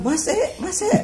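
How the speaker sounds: imitated high, loud hailing; calling out. Friends call him by nickname wherever he goes, hailing loudly.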